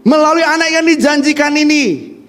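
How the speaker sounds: fiery sermon climax; preaching shout. Real, not acted. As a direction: proclaim it to the whole hall. Preacher at full force.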